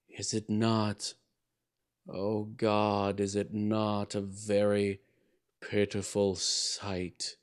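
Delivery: very slow, soft, despairing plea; despairing cries. A narrator's reading. Buried souls crying out in despair, slow and soft. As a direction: drag it out in despair. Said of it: "a tumult of despairing cries".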